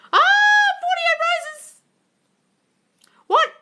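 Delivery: very high, slow gasp of surprise; amazed high exclaim; astonished. Real, not acted. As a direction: marvel at it. Surprised by gift.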